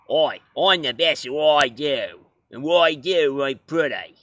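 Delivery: hammed-up Australian tough guy; mock Australian accent. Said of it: "he sounded more like this".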